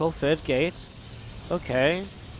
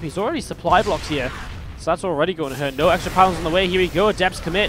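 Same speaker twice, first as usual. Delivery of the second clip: higher, louder excited commentary; live play-by-play; 4 semitones higher, 6 dB louder than usual. Real, not acted. Caster to viewers as adepts commit, excited play-by-play. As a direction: call the clash with raised pitch and energy.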